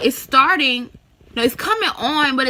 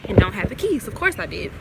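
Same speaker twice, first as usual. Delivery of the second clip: ordinary talk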